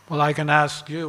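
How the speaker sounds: usual speaking voice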